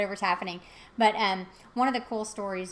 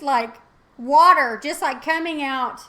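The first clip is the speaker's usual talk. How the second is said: ordinary talk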